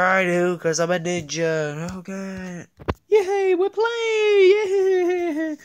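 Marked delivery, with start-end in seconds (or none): 3.1-5.6 high, loud squeal of joy; cheering. Acted. Toys celebrating starting to play, high excited cheers. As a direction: cheer like a thrilled little kid.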